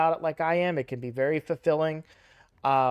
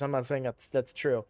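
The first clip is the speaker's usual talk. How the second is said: normal talking voice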